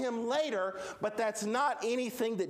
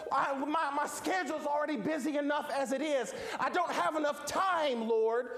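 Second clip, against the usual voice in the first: high-pitched, pleading objections; exasperated protest; 6 semitones higher than usual. Acted. Busy believer asking the Lord why, exasperated. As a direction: pile up complaints in a raised pitch.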